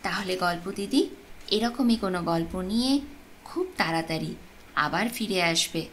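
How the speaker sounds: ordinary delivery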